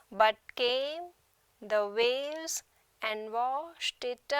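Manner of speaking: "One day / I wrote / her name" slowly, each two-syllable foot apart with rising end; iambic scansion. Shown as a demonstration. Teacher reading Spenser's sonnet to show iambic pentameter, slow foot-by-foot scansion. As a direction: pause after every foot from "One day" to "his prey", stretching the second syllable. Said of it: "which is written in iambic pentameter".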